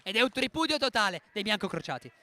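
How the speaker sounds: loud title-winning call; jubilant commentary. Real, not acted. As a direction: belt out the result in triumph. Urlo di telecronaca per il titolo. (telecronaca esultante a gran voce)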